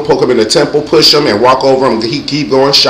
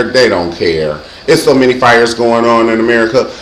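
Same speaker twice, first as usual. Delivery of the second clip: ordinary talk, nothing marked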